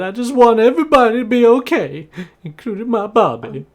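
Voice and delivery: high, loud, teary plea to calm down; emotional motherly woman. Acted. Maud pleading with the boys to calm down, high emotional plea. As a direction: play Maud begging the boys to stop, near tears. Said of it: "and just says".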